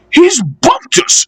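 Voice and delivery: the coaches screaming their complaint. Acted. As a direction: cry out in outrage. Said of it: "screamed the coaches".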